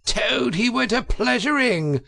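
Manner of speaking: high, yelled mocking line; yelling Toad. A narrator's reading. Toad bursting in on the weasels, a high yell. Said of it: "he yelled".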